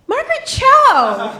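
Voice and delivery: high, loud, slow proud announcement; mock triumphant high shout; triumphant answer. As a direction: declare it like a gotcha. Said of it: "the acquaintances' answer".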